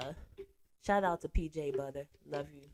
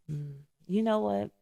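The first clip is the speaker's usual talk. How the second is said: ordinary delivery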